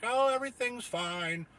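high, slow smug suit talking down; sarcastic bureaucrat voice; mocking bureaucrat. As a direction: parody an official dismissing you. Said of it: "assholes in the suits being like".

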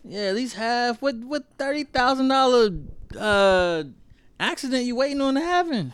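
high, incredulous teasing. Real, not acted. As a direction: tease him in disbelief.

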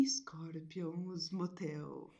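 slow, whispered seductive ad voice; sultry narrator. Pretend. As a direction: breathe the brand name slowly and seductively. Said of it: "essa voz sedutor de Scorpion".

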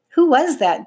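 high, sharp demand. A narrator's reading. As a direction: demand an answer from whoever spoke. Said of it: "demanded the older one".

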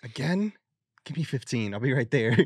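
mock flustered reply. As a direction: play a caught-out parent on the phone. Acted phone reply, flustered mother.

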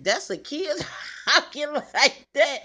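high, loud, slowed with laughter; laughing imitation. Mother imagining the boy's reaction, laughing mimicry.

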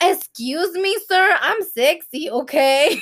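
indignant sass, hammed up; mock offended. Acted comeback to husband's teasing.

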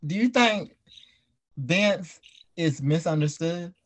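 ordinary talk

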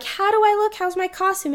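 high-pitched mock girlish questions; high, eager girly voice; imitated girls. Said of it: "the girls are like".

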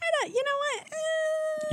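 high squealing laugh. Real, not acted. Mom laughing at her son's bus obsession, high squeal.

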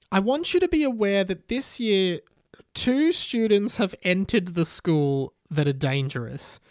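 solemn announcement, starting high then slowing; grave, declamatory school address; Harry Potter's warning. A narrator's reading. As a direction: warn the whole hall deliberately. Voices Harry Potter warning students.

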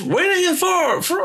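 a hint of a booming ring announcer. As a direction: imitate a wrestling ring announcer, high. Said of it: "ring announcer".

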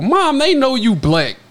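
slow exasperated plea; drawn-out embarrassed child voice. Retold or imitated. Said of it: "My mom did that shit on the phone".

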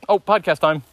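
lightly acted burst of enthusiasm; mock eager. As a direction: pipe up as if suddenly keen. Acting out eagerness to record.

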